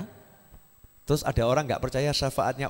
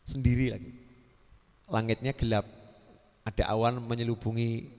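hushed storytelling; 4 semitones lower, 4 dB quieter than usual. Acted. Quiet dark-night story example.

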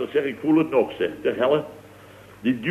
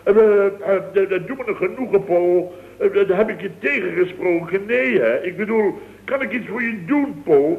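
mock servile fawning; 7 semitones higher than usual. Acts servile toward Paul as a bit.

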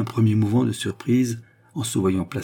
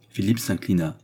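usual speaking voice

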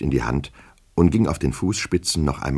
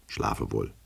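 schlafe wohl said much softer, trailing off; softer, fading good night; 6 semitones lower, 10 dB quieter than usual. A narrator's reading. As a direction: let the second schlafe wohl drift away quietly. Repeated good night, fading away. (leise verklingend: schlafe wohl)

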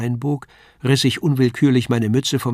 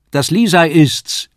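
higher, louder and slow announcement; excited call; 5 semitones higher, 8 dB louder than usual. A narrator's reading. Paul brings Lisei home to the landlady, excited call. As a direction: announce her arrival with delight. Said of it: "rief ich".

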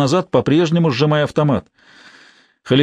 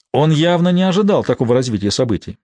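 plain reading voice

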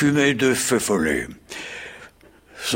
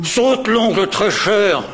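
slow, high, louder angry order; angry cry; 7 semitones higher, 3 dB louder, slower than usual. Acted. Bellman rings his bell, angrily silencing the baker. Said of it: "en faisant retentir rageusement sa cloche".

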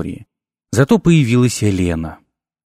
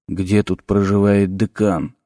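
hoarse stranger; 4 semitones lower, 4 dB quieter, slower than usual. A narrator's reading. Cloaked stranger grabbing the narrator, low rasping demand. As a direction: rasp it out low and slow like a cloaked menace. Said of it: "раздался из-под капюшона хриплый голос".

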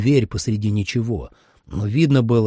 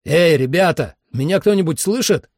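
raised, higher-pitched call to the team; 7 semitones higher, 3 dB louder than usual. Acted. Narrator calls for his missing team, raised call. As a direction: call out to anyone who can hear. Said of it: "крикнул я".

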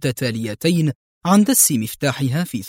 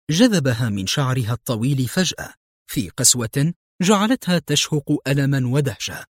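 ordinary delivery

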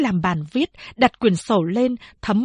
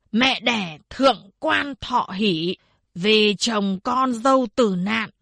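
formal proclamation; 2 semitones higher than usual. Acted. Village head formally announces death.

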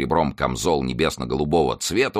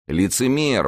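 higher-pitched, sudden exclamation; accusing exclamation; 8 semitones higher, slower than usual. A narrator's reading. Master calls out his servant's pretence, exclaiming. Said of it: "воскликнул Д'Артаньян".

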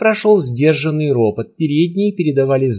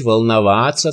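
ordinary delivery, nothing marked

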